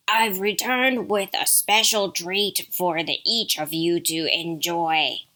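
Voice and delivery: gruff wolf, slowing at the end; growly wolf coaxing as if mother; deep gruff wolf. A narrator's reading. Wolf promises the kids a treat, deep and gruff. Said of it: "You have a deep, gruff voice".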